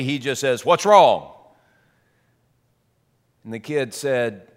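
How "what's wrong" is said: "What's wrong?" said out loud, blunt and carrying; loud, not quietly. Retold or imitated. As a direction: bark "What's wrong?" across the gym. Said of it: "he doesn't say it quietly".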